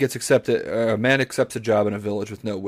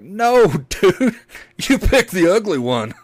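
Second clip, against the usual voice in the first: laughing mockery; 8 semitones higher, 7 dB louder, slower than usual. Guys mocking him, laughing.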